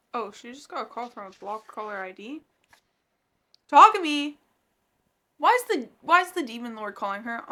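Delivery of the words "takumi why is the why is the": loud shocked yell; outraged shout. Real, not acted. Reader yelling as Takumi calls Hachi. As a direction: call out the name in alarm.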